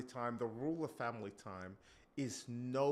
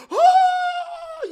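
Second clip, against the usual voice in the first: very high, loud shocked cry; panicked oh; 22 dB louder than usual. Acted. Wife realizing the affair is real, a loud panicked cry. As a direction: yelp in sudden panic.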